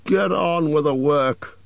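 high, slow, irritable order; growled order. A narrator's reading. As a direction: order them on testily, drawing the words out. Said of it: "growled the butler".

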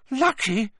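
high-pitched indignant shriek, not very loud. A narrator's reading. Hermione rounds on Ron, shrieking. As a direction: shriek it in disbelief. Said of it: "shrieked Hermione".

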